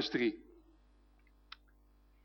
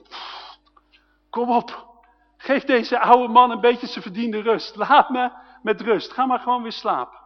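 huffing, then pleading to be left alone; exasperated old priest; 3 semitones higher, 4 dB louder than usual. Acted. Tired old Eli wants his rest from the boy, exasperated. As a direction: puff out and grumble up to a louder complaint. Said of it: "ik kan me zo voorstellen dat Eli denkt".

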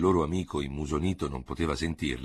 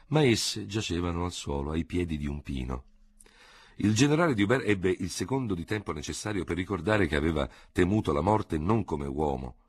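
usual speaking voice

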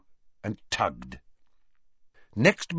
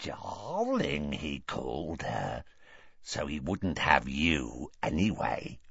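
low, soft serpent taunt; whispered Parseltongue; 8 semitones lower, 8 dB quieter, slower than usual. A narrator's reading. Morfin taunts his sister, low serpent whisper. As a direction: needle her in a hushed, low hiss. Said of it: "whispered Morfin in Parseltongue, looking at his sister".

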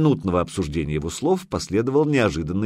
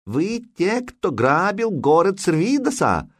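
high, slow, halting foreigner's Russian; broken Scandinavian accent; 10 semitones higher, slower than usual. A narrator's reading. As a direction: ask it like an interpreter mangling the language. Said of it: "на скверном скандинавском спросил переводчик".

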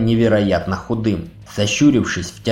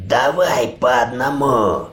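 hoarse growl; slower than usual. A narrator's reading. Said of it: "прохрипел Ури".

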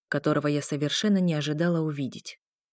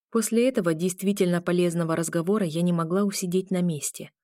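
plain reading voice

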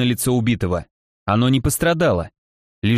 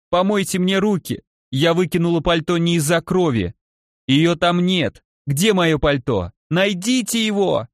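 высокий истеричный крик; кричит в панике, на грани срыва; frantic shouting hostage taker; 5 semitones higher than usual. Acted. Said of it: "истерично закричала она". (истерично кричит следователю)

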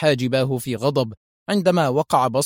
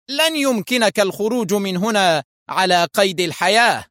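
fierce; 7 semitones higher than usual. A narrator's reading. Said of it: "قال فوستر في شراسة".

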